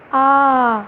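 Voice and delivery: আ held long and steady; long vowel. Shown as a demonstration. Teacher showing students it takes much time, long drawn-out আ. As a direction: draw আ out to show its length. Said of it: "দেখো অনেকটা time লাগছে".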